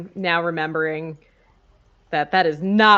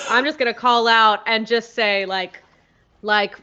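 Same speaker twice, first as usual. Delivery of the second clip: ordinary talk, nothing marked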